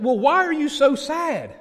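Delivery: really confused. Acted. Friend questioning his sad buddy, really confused. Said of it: "the friend was really confused".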